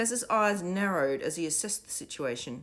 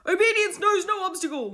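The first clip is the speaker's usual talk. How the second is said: ordinary delivery, nothing marked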